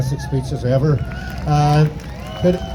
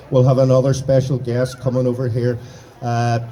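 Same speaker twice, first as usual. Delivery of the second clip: ordinary talk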